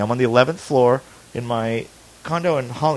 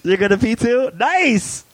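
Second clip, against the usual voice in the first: high, delighted mock cheer; put-on thrill in a high voice; mock excitement; 11 semitones higher, 3 dB louder, slower than usual. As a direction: react with exaggerated glee, pitched up. Joking as a friend leaves to pee.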